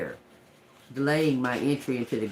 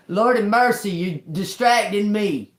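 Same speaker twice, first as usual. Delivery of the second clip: exasperated outburst; 8 semitones higher, 6 dB louder than usual. Real, not acted. Scolding the bird mid-reading.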